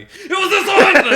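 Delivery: very high, loud drawn-out shriek; imitated friend's scream. Friend imitating the scream heard behind them, high shriek. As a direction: shriek it slowly, mimicking a panicked rider. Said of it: "that's when I hear behind me".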